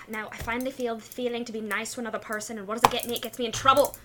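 muttering to oneself. Acted. Climber complains to self while fleeing creatures, muttering. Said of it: "I'm muttering myself the whole time".

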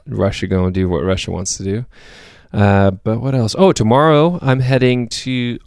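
ordinary delivery

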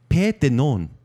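"paix de non" said the French way, nasal ending; French pronunciation. Shown as a demonstration. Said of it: "French name said in French".

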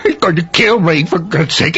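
low, rushed, frightened begging; terrified plea. Acted. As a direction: gasp it out in terror. Terrified man begging for hiding.

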